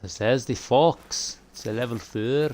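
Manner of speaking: slow, lightly Scottish-voiced fox call; Scottish accent. Shown as a demonstration. Gamer calling the fox in a Scottish accent. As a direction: roll it out in a mild Scottish accent. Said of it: "That was my Scottish accent".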